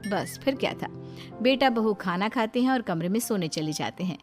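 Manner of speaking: usual speaking voice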